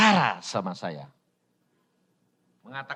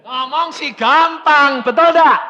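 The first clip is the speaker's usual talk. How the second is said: preaching challenge; 12 semitones higher, 10 dB louder than usual. Acted. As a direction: throw it at the congregation. (menantang jemaat dengan lantang)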